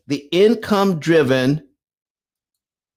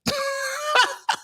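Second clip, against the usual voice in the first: sudden squealing laugh, much higher; high shrieking laugh; 7 dB quieter than usual. Real, not acted. Host cracking up at own joke, high squeal.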